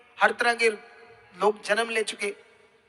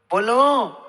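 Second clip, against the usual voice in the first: call to congregation; 4 dB louder, much slower than usual. Real, not acted. Repeats the call, louder.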